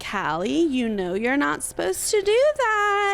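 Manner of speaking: put-on high, slow baby-talk reproach; gentle dog scolding. Shown as a demonstration. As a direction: coo a telling-off to your pet. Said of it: "This is what I do when Callie".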